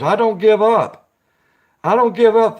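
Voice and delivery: emphatic insistence. Real, not acted. Telling a viewer he keeps praying, emphatic. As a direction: drive home that he won't quit.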